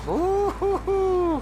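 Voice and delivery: high, slow lecherous hoot; excited hoot. Acted. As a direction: hoot like a drunk at the dames. Drunk Paulie thinks of dames.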